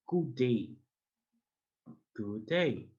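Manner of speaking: ordinary talk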